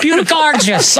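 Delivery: high Irish lilt; cousin Pat. Retold or imitated. Cousin Pat gushing in an Irish accent. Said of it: "cousin Pat in Ireland".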